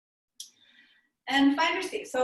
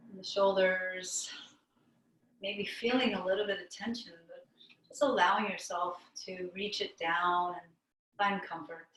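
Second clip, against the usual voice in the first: calm meditation teacher with long pauses; guided meditation; 2 semitones lower, 4 dB quieter than usual. Real, not acted. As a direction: guide them inward, unhurried, leaving long silences between phrases.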